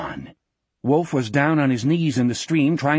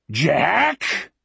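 drawn-out bellowed name; 3 semitones higher than usual. A narrator's reading. Uncle Morgan calling Jack over the noise, bellow. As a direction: call the name out over thunder. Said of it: "a voice bellowed over".

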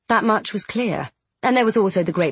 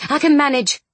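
higher, curt brush-off; snappish; 7 semitones higher, 4 dB louder than usual. A narrator's reading. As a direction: clip it short, a little annoyed. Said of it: "she said a bit snappishly".

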